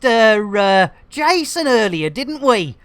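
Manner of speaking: ordinary talk, nothing marked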